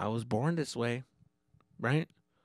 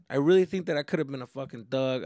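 ordinary talk

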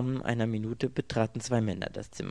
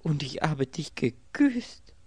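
high, slow, louder cry of distress; deepest distress; 7 semitones higher, 6 dB louder, slower than usual. A narrator's reading. Girl realises she kissed a stranger, crying out in distress. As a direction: draw it out in raised, anguished dismay. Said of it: "rief sie in einem Ton tiefster Not".

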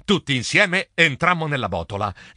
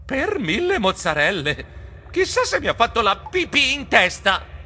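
higher, fretful outburst; dismayed exclamation; 8 semitones higher than usual. A narrator's reading. Geronimo pales after the bat escapes, exclaiming in dismay. Turns pale, dreads bat mess.

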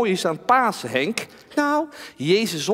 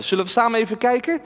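ordinary talk, nothing marked

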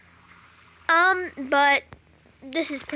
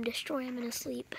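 hushed scheming whisper; 10 dB quieter than usual. Real, not acted. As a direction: whisper it like a secret plan. Scheming aside to camera.

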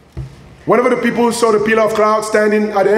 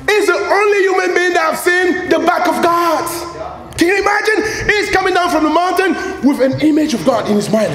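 excited; 9 semitones higher than usual. Real, not acted. Excited preaching.

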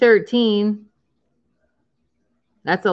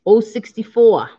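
bingo caller voice; 4 semitones higher, 4 dB louder than usual. Pretend. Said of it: "is my mass voice good".

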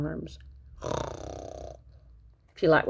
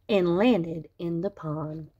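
usual speaking voice

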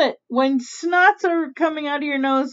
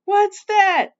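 high, pointed question; mock suspicion, pitched up; suspicious; 9 semitones higher, 4 dB louder than usual. Mother Witch eyeing the soup brought to her, suspiciously. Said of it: "said Mother Witch suspiciously".

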